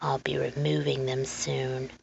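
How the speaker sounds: low, quiet threat; soft and menacing. Acted. Man telling Lenora he'll remove the books, softly. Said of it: "the man said softly".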